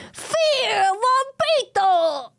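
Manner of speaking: high, slow hammed-up proclamation; drawn-out theatrical cry; dramatic villain cry.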